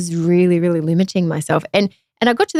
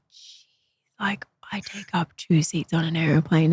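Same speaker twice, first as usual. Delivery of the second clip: hushed ashamed whisper to herself, slowed; 2 semitones lower, 7 dB quieter, slower than usual. Retold or imitated. Alone on the plane, whispering her shame to herself. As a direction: breathe it out unvoiced, barely audible. Self-talk recalled on the plane.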